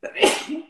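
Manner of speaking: a sudden real sneeze; sharp loud sneeze burst; sneezing. Real, not acted. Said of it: "uy, voy a estornudar".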